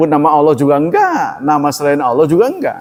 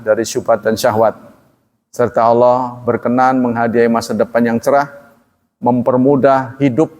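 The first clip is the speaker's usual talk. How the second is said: lower, even prayerful close; closing prayer; 5 semitones lower than usual. Acted. Preacher closing the study session with a prayer for listeners, devout. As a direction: bless the audience gently.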